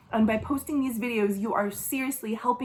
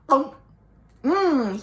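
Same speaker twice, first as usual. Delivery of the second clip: ordinary delivery, nothing marked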